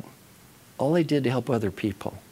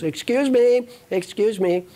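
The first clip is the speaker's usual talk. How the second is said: high, loud mock hail for attention; hammed-up, pitched-up interruption; insistent interrupting; 9 semitones higher, 3 dB louder, slower than usual. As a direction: pipe up urgently as the eager questioner. Banker interrupting, acted out.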